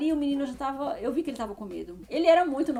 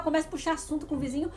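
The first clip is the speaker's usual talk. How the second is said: normal talking voice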